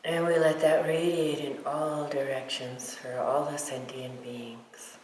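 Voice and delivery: guided meditation. Real, not acted. Teacher guiding meditators to feel love for all beings, guided meditation. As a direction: lead them gently, leaving silence between phrases.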